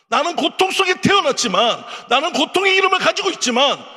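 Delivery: high, loud pulpit proclamation; fervent preaching. Acted. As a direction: build the sermon to a ringing climax. Preaching climax about Jabez.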